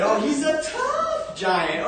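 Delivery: high and slow, a touch of awe; a small boy sizing up a tough giant; impressed little boy. Acted. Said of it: "So he said".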